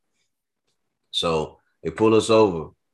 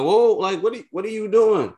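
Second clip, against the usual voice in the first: much higher, startled cry of disbelief; shocked alarm; 6 dB louder than usual. Retold or imitated. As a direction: jump up in pitch like you just saw a gun. Gun pointed at car, shocked.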